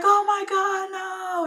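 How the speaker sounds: high, drawn-out mock wail; imitated shock. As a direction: cry out in dismay, high-pitched. Imitates cellmate hearing of the death.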